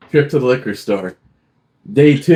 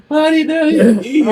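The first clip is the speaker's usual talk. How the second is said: high laughing voice. Real, not acted. Son cuts in laughing.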